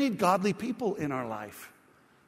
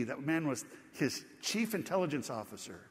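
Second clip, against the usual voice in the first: ordinary delivery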